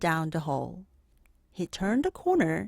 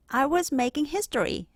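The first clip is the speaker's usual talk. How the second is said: put-on higher, fast cheeky boy voice; 8 semitones higher, faster than usual. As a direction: toss it off proudly. Arthur's cheeky answer.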